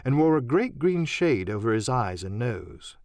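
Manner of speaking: usual speaking voice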